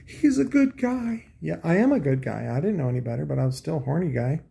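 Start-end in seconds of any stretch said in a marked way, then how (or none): 0.0-1.3 put-on high, slow, sing-song girl voice; mock girly voice. Girls he dated saying he's a good guy, in a high mock voice. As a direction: lightly mimic the girls gushing about him. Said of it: "And then they were like".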